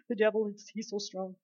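soft, feeble mock fear; hammed-up gasp, then a small fearful voice; mock cowering. Preacher imitating believers scared of the devil, mock cowering. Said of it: "cowering in fear and like".

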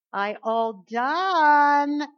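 a second, sweet-but-firm call; lightly voiced insistent toddler; toddler calling again. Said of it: "she'll say it twice".

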